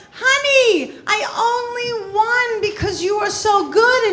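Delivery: sugary sweet. Retold or imitated. Sweet fake compliment to opponent.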